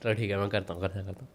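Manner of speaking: ordinary talk, nothing marked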